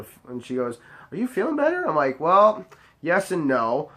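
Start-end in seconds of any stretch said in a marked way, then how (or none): 1.1-1.9 much higher, sugary mock question; mock her fake sweetness, pitched way up; mock sweet voice. Mimicking the attorney asking him a question. 2.2-3.9 drawn out, slow sarcastic start; slow sarcastic reply. Retold or imitated. Answering the attorney with slow sarcasm.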